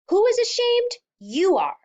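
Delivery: very high, loud accusing yell; furious shout. Acted. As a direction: hurl the accusation at her. Father's peak accusation.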